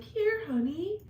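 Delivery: put-on high, sweet girlfriend coo. Pretend girlfriend pampering a viewer, high and sweet. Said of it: "I'd be like".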